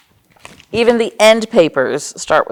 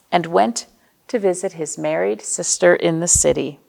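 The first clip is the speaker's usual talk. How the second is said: plain reading voice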